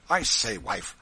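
loud, abrupt hail; calling to wife. A narrator's reading. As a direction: break the silence, addressing your wife loudly. Husband breaks silence to hail wife.

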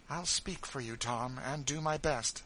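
firm kindly promise; Miss Ophelia. Acted. Miss Ophelia promises Tom help, lightly voiced brisk lady.

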